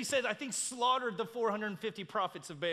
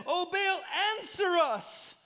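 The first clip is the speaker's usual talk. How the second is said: high, raised cry to Baal; shouted plea; 9 semitones higher, 5 dB louder, slower than usual. A narrator's reading. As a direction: call up to a silent god. Prophets' repeated shout to Baal.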